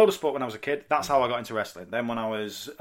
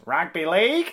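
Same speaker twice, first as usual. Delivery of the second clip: put-on broad Australian accent, louder and higher; 5 semitones higher, 5 dB louder, slower than usual. Imitating an Australian friend trying to feel Australian, broad Aussie accent. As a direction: blurt it in a hammed-up Aussie accent. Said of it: "How do you make yourself feel Australian?".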